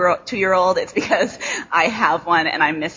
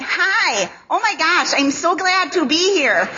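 high, bright-sounding nasal Midwest gush, hammed up; mock her own Midwest twang with a high gushing hello; exaggerated Midwest accent; 9 semitones higher, slower than usual. Speaker mocking her own accent in Nashville, hammed-up high Midwest gush. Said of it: "everything I say is like".

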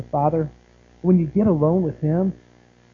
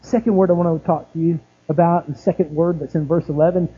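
normal talking voice

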